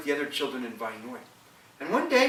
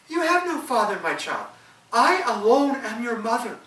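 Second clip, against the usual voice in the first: raised, firm mother's voice; mother's reply; 8 semitones higher, 5 dB louder than usual. Acted. Mother telling her son he has no father, firm raised voice. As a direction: insist gently but firmly.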